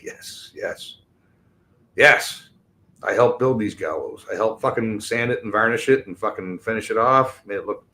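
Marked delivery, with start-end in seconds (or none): 0.0-2.5 gloating yes. Pretend. Imagining the hanging, a gloating whisper of yes. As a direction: savour it as the lever drops. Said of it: "I'll be like".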